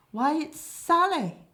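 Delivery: very high, loud, slowed exclamation of discovery; exclaiming. Acted. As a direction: cry out her name in delight. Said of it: "He fumbles around her bum and exclaims".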